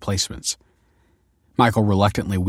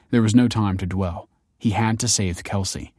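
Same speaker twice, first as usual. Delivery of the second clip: ordinary delivery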